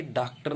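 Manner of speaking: डॉक्टर said with a long aa, "daak-tar"; wrong aa vowel. Shown as a demonstration. Teacher showing what not to say, डॉक्टर with a plain "aa". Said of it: "नहीं बोलेंगे".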